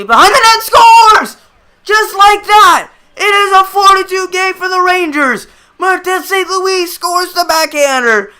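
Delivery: high, loud call, slowing on the punchline; goal call shout. Real, not acted. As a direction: yell it in disbelief at the quick goal.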